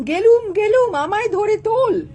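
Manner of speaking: high, loud comic cry for help; panicked outcry. Acted. Boss babu suddenly calling to be lifted up, panicked high cry. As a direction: wail in sudden alarm. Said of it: "হঠাৎ বলেন".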